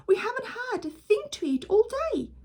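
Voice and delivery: high, worried mother voice. Acted. Mother fretting about food, high.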